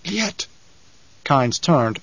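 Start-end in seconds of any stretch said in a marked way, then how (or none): 0.1-0.5 hushed, high whispered name; awed whisper. A narrator's reading. Crewman naming Kynes among the others, whispered. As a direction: whisper it, far softer. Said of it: "One of them whispered".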